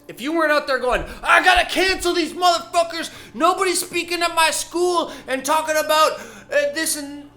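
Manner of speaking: yelling at cancel culture; ranting shout. Real, not acted.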